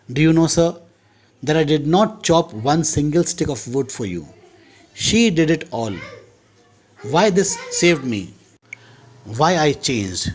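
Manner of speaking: slow, careful English read-aloud; story reading. A narrator's reading. Teacher reads the Chekhov story to students, slow and careful. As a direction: read each phrase slowly for the class.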